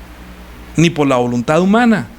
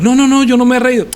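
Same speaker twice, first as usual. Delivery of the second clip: negación asustada, alzada; 7 semitones higher, 5 dB louder than usual. Acted. Sara niega haberse reído.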